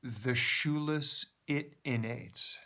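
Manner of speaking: slow, careful reading of a reversed phrase; backwards speech. Shown as a demonstration. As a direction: spell out the heard words slowly. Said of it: "what I hear her saying backwards is".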